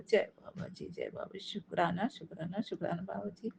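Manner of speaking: very soft, hushed thanks; quiet reverent muttering; murmured devotional thanks. Real, not acted. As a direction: murmur it under the breath to the guru. Murmured thanks after the bhajan.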